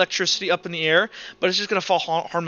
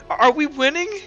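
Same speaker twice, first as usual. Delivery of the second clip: hesitant, high-pitched question rising at the end; Kilroy asking; 10 semitones higher, 4 dB louder than usual. Acted. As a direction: stammer it out high and unsure. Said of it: "to Uhtred and kinda go".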